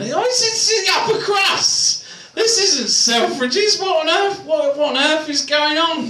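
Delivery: high-pitched exasperated protest; mock outrage. Comedian aghast at a posh order in Upper Crust, mock outrage. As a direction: blurt it out high and incredulous.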